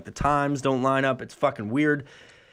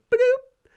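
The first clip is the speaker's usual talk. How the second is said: mock notification chime; 5 dB louder than usual. Calendar popup imitated.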